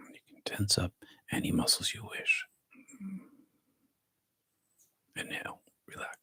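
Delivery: soft, hushed meditation guidance; guided meditation whisper. Acted. Teacher guides the group through body relaxation, soft whisper.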